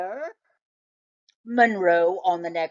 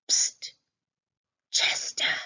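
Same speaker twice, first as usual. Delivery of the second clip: hushed whisper in the dark. A narrator's reading. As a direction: breathe it so nobody hears. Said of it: "dark kitchen hushed".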